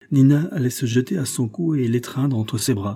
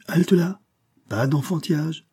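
sharp rebuke; 7 semitones higher than usual. Acted. Verduret stopping Fanferlot's idea.

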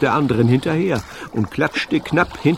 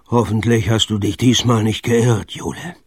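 ordinary delivery

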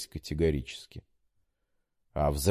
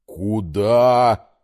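loud stunned куда; taken aback; 2 semitones higher, 7 dB louder than usual. A narrator's reading. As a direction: blurt it out in shock. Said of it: "опешил Виталий Юрьевич".

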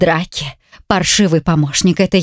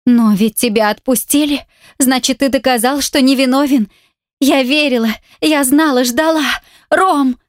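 a wife pleading with an escaped husband, rising higher; rising desperate plea; 8 semitones higher than usual. A narrator's reading. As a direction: pitch climbs higher line by line.

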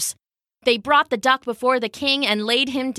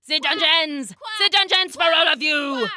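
higher-pitched threat in a tyrant's voice; desperate king; 6 semitones higher than usual. A narrator's reading. King threatening the whole crowd, desperate anger. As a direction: cry out the threat over a chanting crowd.

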